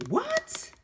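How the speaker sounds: very high, rising squeak of disbelief; incredulous. Acted. Shopper amazed at two-dollar fruit, incredulous. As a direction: shoot right up in pitch, astonished.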